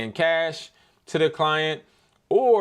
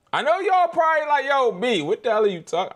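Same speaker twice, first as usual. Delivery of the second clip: hyped up; 11 semitones higher, 5 dB louder than usual. Real, not acted. Imagining viewers' confused reaction.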